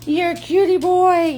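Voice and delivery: a light doting pet voice. Real, not acted. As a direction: coo it at the puppy. Baby talk to her dog.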